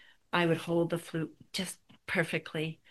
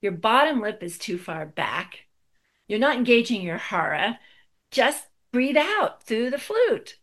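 firm, louder corrections; gentle bark; 4 semitones higher, 7 dB louder than usual. Retold or imitated. Teacher correcting the student's playing, a gentle bark. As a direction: bark it out, but kindly. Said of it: "he barked, a rather gentle bark".

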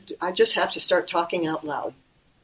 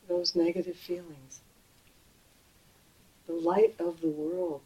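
normal talking voice

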